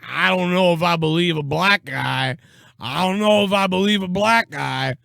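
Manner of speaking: hammed-up caricature of a doubter; mock bigot. Podcaster voicing a man in the audience, hammed-up bigot caricature. Voices a sour-looking audience member.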